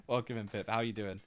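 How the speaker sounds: ordinary talk, nothing marked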